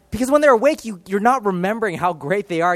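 ordinary talk

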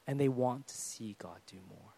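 breathy whispered aside to the church. Real, not acted. Preacher whispering to the congregation about young believers' faith, a low whisper. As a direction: hush it right down to a whisper. Sermon point dropped to whisper.

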